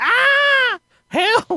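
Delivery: very high, squeaky hammed-up wail; mock cry for help. Narrator to friends, locked up: very high mock wail. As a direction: wail helplessly like a cartoon victim.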